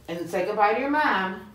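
normal talking voice

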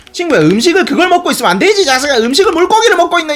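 fast, loud, high scolding. Real, not acted. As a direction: scold them loudly and quickly. Scolding enemy eating fish.

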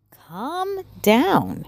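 Molly's voice. Acted. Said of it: "said Molly".

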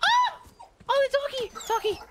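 high excited squeal over the dog; delighted squeal. Real, not acted. Gamer squealing at the dog, high and delighted. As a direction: gush over the doggy, high and thrilled.

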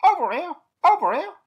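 high squawking call, loud and shrill like a parrot; squawky bird voice. A narrator's reading. Hidden bird calling to Eric, high squawky shout. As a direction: squawk it out from the trees. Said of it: "a squawky voice from the woods said".

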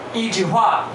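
ordinary talk, nothing marked

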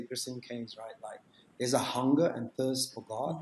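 usual speaking voice

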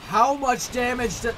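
high, loud, cut-off yell; outraged shout. Real, not acted. Player hit by the rat mid-sentence, outraged shout. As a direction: burst out in disbelief. Outburst at rat's damage.

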